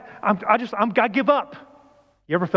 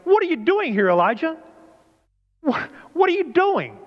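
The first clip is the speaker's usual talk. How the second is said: God challenging; 9 semitones higher than usual. Acted. God confronts runaway Elijah, high and demanding. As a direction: call him out sharply, pitched high. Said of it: "the word of the Lord came to him".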